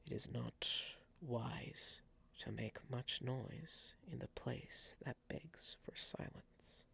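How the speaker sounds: slow, hushed whisper; eerie masked figure speaking in a slow whisper; soft, quiet speech. Acted. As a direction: whisper it slowly, just loud enough to hear. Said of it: "softly, quietly, but loud enough for you to hear".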